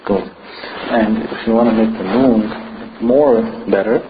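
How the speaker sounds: ordinary delivery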